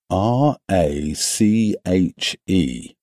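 each letter slow and separate; spelled out. A narrator's reading. Narrator spells the bloody word slowly, letter by letter.

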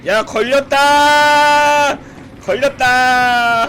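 loud, high, long-held final vowels; drawn-out shout. Real, not acted. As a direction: cry it out, stretching the last syllable. Calls out being caught in game.

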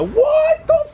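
very high, drawn-out shriek; long piercing cry of shock; real shocked scream. Real, not acted. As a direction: scream it in stunned disbelief. Reading tornado alert in storm clip.

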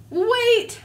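high excited cry; excited shout. Acted. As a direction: call out, startled. Character shouts in story.

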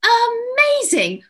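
amazed. Acted. News host reacts to shepherdesses.

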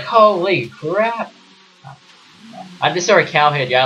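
drawn-out high yelp; slow stretched startled cry; retold shock. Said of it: "And I was like".

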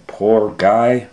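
plain reading voice

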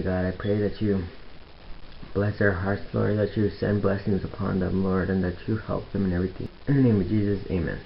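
soft, hushed prayer. Real, not acted. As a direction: pray quietly and sincerely. Prays quietly.

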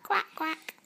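playful quack quack; duck quack. Pretend. Imitating duck.

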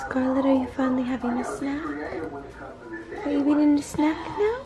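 high, sweet praise. Real, not acted. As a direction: encourage her gently. Mother praising eating baby.

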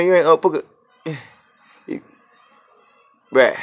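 usual speaking voice

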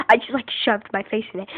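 ordinary delivery

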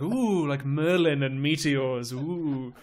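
slow, loud mock-spooky oohing; superstitious Welshman. Reader teases Shakespeare's Welshmen, mock superstitious. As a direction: draw it out in mock mystic awe. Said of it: "they're always really superstitious".

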